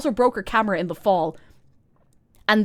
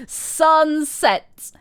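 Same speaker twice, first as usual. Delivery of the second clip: Sunset mocked, higher and louder with a falling end; mock emphatic; 8 semitones higher, 7 dB louder than usual. Shown as a demonstration. Mocking the cousin's curfew, higher and louder. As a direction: throw Sunset back at her in mockery.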